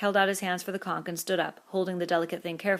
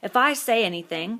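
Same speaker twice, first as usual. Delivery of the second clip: bitter complaint; 4 semitones higher, 7 dB louder than usual. A narrator's reading. Piggy complaining.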